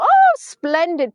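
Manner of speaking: high, loud, drawn-out exclamation; mock delight. Recalling a first reaction to the new extension rod, mock delight. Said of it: "which I thought".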